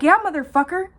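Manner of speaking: loud, high, defiant taunt after splashing him; defiant yell. Retold or imitated. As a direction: yell it in his face in triumph. Splashing coffee on the man.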